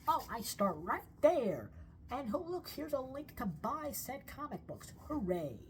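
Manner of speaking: high, drawn-out happy discovery; hammed-up cheery relief; mock delight. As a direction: play a website user thrilled to find it. Acting delighted website user.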